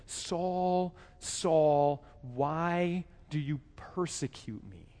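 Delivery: soft, slow, drawn-out call of the divine voice; a hushed heavenly voice asking Saul why; voice of God calling. Acted. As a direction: call his name gently, then ask slowly. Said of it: "he heard a voice say to him".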